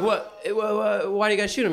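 high, stammering disbelief; high flustered questions; surprised. Retold or imitated. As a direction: balk at the idea. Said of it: "I was like".

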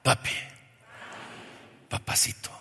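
unvoiced, soft call to a father; whispered tender call. Shown as a demonstration. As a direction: breathe it like a child. (susurro tierno)

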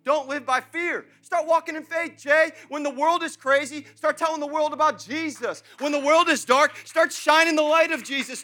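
loud, high, urgent exhortation; full-force preaching. Real, not acted. Pastor urging the congregation not to worry, full-force preaching. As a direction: preach it at full voice, driving each command.

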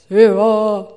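very loud mock voice of God; booming God voice. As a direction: boom it out like God calling from above. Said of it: "a big booming voice that's like".

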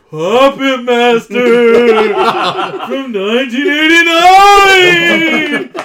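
loud, slow, high spooky announcer. Pretend. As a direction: announce it like a horror trailer. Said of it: "can you say all spooky".

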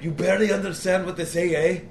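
quick, run-together Scottish accent demo. Shown as a demonstration. Showing how the Scottish go around talking, Scottish accent. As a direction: race through it in a thick Scottish accent. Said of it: "the Scottish just go around talking like this".

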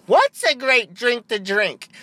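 high, drawn-out, hammed-up echo; mock ad stooge. Second voice in fake ad asks back, hammed-up and slow. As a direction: answer slowly in an exaggerated put-on commercial voice.

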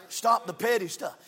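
ordinary talk

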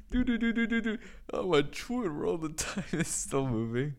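a high, sing-song Cumia mimicking his Twitter typing; lightly mock the typing fingers; Anthony Cumia impression. Streamer showing his Anthony Cumia impression, high sing-song. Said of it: "this is my impression of, uh, Anthony Cumia".

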